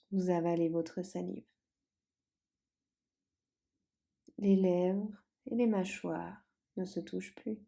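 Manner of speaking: calm Yoga Nidra guidance, unhurried, long pauses; guided meditation. Acted. Leads a guided relaxation.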